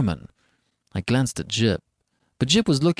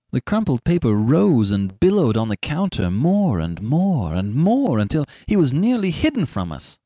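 usual speaking voice

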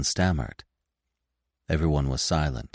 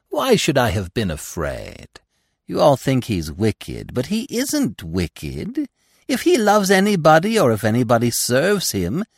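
superior; 6 semitones higher, 4 dB louder than usual. Acted. Gobo's superior reply.

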